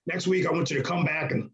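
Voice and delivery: lightly voiced boss impression; Vince impression. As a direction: give orders in a put-on boss voice. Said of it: "That's a great Vince impression".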